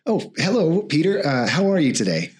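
high, cheerful mayor greeting. Acted. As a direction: lift into a bright town mayor.

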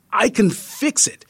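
high-pitched, urgent offer; insist, pitch rising with mild panic; anxious insistence. A narrator's reading. Guide tries to keep the tourist, in anxious insistence.

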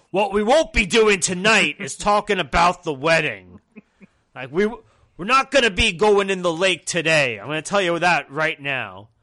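loud, bossy camp counselor voice; Triple H as camp counselor. Retold or imitated. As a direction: lay down the rules to the campers, laughing through it. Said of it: "head counselor at a camp energy".

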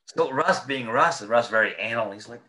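normal talking voice

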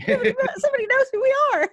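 very high, giggly mock thrill; excited squeal. Echoing co-host about being noticed, excited squeal. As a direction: echo it in a laughing squeal.

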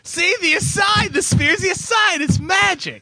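high laughing shout. Real, not acted.

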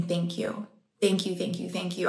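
soft, repeated thanks; murmured, heartfelt thank-yous; hushed gratitude. Retold or imitated. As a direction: relive overwhelmed thanks quietly. Relives tearful gratitude.

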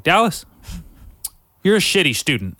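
loud, high telling-off of Dallas; mock teacher scolding. Teacher to the student after class, loud scolding. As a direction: call the boy out loudly.

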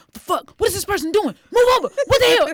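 high, loud exasperated yell at other drivers; mock road rage, shouting at the windshield; yelled in irritation at bad drivers; retold angry yelling. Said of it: "he was just like".

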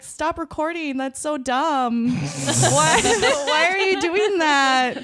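playful indignant whine, rising to loud; mock scolding. Retold scolding of partner recording.

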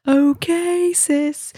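a hint of a dad humouring his daughter; dad's dry reply. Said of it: "he was like".

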